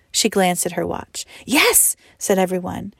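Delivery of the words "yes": put-on high chirpy children's yes; chorus of children. A narrator's reading. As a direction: answer like eager kids. Children answering teacher.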